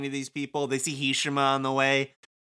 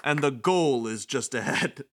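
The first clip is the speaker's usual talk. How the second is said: theatrical taunting announcer. Acted. Game villain greets the sheeple of Shibuya, theatrical taunting announcer. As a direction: relish each phrase like a gloating host.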